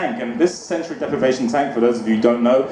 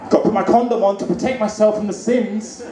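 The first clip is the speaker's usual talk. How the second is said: a touch higher, matter-of-fact mock voice; silly mask guy; 6 semitones higher than usual. Mask guy at the pool, mock earnest. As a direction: play him as dead serious. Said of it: "imagine when he's going to church".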